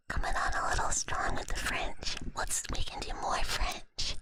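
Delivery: hushed stream chat, all whispered. Real, not acted. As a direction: whisper to the listeners.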